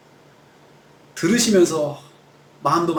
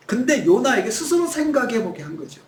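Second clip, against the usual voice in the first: ordinary talk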